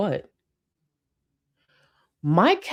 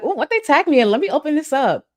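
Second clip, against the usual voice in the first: "Oh, what they tagged me in?" pitched high and louder, eager; excited self-quote; 10 semitones higher, 5 dB louder than usual. Retold or imitated. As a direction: replay your own giddy curiosity at a new tag. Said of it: "I get so excited".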